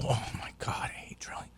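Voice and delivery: hushed whisper of dread at the drill. Retold or imitated. Patient in dentist chair dreading the drill, whispered. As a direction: breathe it out under your breath.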